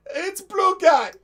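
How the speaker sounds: high, loud, very slow alien voice; Chiss voice. Retold or imitated. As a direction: drag it out high and loud, smug blue alien. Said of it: "just Nash's Chiss going".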